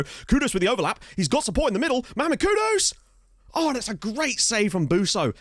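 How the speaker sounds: rising football call, peaking on the player's name; excited play-by-play. Real, not acted. Gamer follows Kudus's overlap to a save, excited commentary. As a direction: build excitement as the attack reaches the shot.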